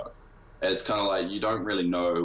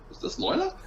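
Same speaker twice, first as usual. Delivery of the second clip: sudden high squeak of surprise; surprised disbelief. Retold or imitated. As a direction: pitch it way up in amazement. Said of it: "I was like".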